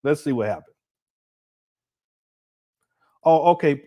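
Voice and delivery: usual speaking voice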